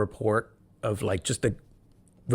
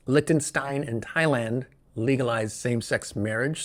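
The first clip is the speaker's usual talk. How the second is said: normal talking voice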